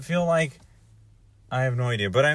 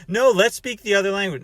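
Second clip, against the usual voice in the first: insistent, louder pushy stranger; 4 semitones higher, 6 dB louder than usual. Retold or imitated. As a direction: press them to switch languages. Pushy stranger in retold argument.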